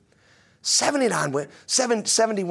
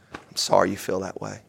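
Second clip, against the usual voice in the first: calm reply; 8 semitones lower, 3 dB quieter than usual. Pretend. Calm reply to someone yelling.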